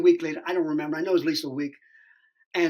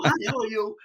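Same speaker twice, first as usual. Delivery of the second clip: higher, put-on hello; totally different voice; 4 semitones higher, faster than usual. Actor greets casting caller, totally different voice. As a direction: lift the pitch into a polite greeting. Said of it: "it's, like, totally different".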